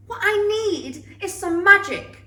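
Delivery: usual speaking voice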